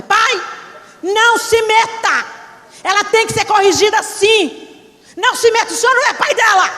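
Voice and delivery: angry tone. Retold or imitated. Daughter loudly tells her father not to interfere. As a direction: snap at your father to stay out. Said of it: "Nesse tom".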